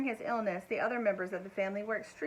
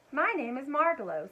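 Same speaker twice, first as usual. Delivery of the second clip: soft musical voice; 5 semitones higher, 5 dB louder than usual. A narrator's reading. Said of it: "said the bird softly in a musical voice".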